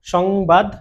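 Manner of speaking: ordinary talk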